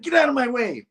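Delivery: high-pitched pushing-through cry; urgent mock yell. Janice running for the subway, high urgent yell. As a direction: yell it as a fleeing woman. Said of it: "She's like".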